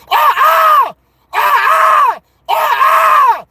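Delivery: high shouted war cries, ah ah ah; imitated victory cry. Retelling the movie's ending to a friend, high shouted war cry. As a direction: belt out a warrior's victory cry. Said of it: "the victory cry".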